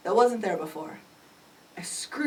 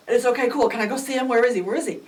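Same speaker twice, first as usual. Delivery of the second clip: eager, raised voice firing questions; excited; 4 semitones higher, 8 dB louder, faster than usual. Retold or imitated. Mother asking police where her son is, excited. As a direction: rush the questions out, thrilled.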